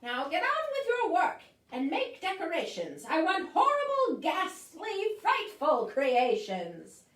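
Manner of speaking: high loud witch, slowing on the last words; bossy witch. Acted. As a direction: order them about, savouring the horror. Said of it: "said the witch".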